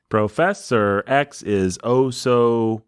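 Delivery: slow, evenly stretched "Professor X is oh so"; metered sounding-out. Pretend. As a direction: measure out each beat of the verse. Composing a line of verse.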